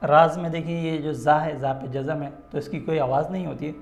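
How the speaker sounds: usual speaking voice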